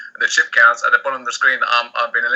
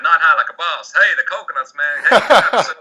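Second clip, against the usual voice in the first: higher-pitched imitation American accent; 5 semitones higher than usual. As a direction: mimic excited American fans in their accent. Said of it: "That's your American accent".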